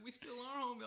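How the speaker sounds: high, put-on pleading voice; plaintive plea. Skater mimics man walking away, plaintive. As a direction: imitate someone clinging to a friendship.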